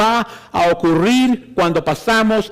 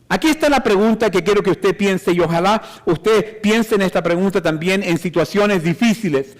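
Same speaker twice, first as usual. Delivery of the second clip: ordinary delivery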